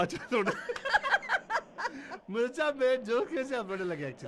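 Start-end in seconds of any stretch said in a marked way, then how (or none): 0.6-2.2 very high-pitched burst of laughter; real giggling fit; big laugh. Real, not acted. Host laughs at crying joke.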